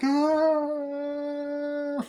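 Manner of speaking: long high mock scream; a high wail as she falls; falling scream. Woman flying out the window, long high mock scream. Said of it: "flies out the window like".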